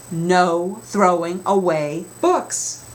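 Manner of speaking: usual speaking voice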